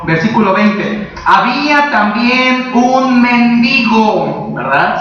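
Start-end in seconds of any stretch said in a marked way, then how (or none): 1.2-4.5 "mendigo" stressed on the I, men-DI-go, read slowly; corrective pronunciation. Shown as a demonstration. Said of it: "Se pronuncia allí mendigo".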